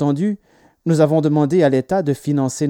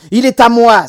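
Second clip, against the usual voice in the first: high, loud possessive shout; cried out; 7 semitones higher, 10 dB louder than usual. A narrator's reading. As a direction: shout your claim like an outraged child. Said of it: "s'écria Peter".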